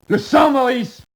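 cri aigu et fort sur « sang ». Acted. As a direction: hurle « Le sang » comme une découverte. Cri soudain de révélation. (cri perçant et effrayé)

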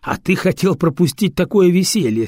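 ordinary delivery

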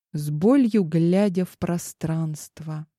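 ordinary delivery

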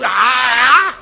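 loud drawn-out yell; exasperated mock scream. Preacher gives up reciting the healing steps, exasperated mock scream. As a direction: break off the list with a long frustrated cry.

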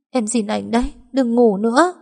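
put-on begging her dead boyfriend not to sleep; wailing. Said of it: "Tôi lớn tiếng gào khóc". (gào khóc van xin)

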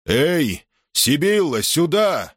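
high calling out to outside. A narrator's reading. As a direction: call him in from the tent. Prince calling out the door.

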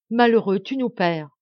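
a ruined father shouting at his son; despairing shout. A narrator's reading. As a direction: shout it in anguish. Desperate duke scolds son.

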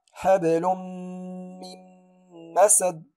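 حبل من مسد with both nuns merged into meem; tajweed recitation. Shown as a demonstration. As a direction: recite حبلم-مم-مسد with ghunna. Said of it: "أقول".

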